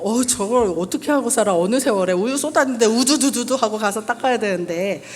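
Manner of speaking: loud, hammed-up incredulous grumble; exasperated disbelief. Speaker voicing the audience's scoffing thought, loud and hammed-up. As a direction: play up the audience's scoffing doubt. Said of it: "이렇게 한 번 봐갖고는요".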